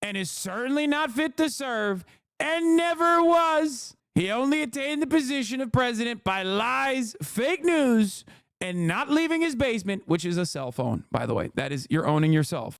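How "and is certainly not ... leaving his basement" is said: Donald Trump impression. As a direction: read the statement as a hammed-up Trump. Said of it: "Let's see what he had to say".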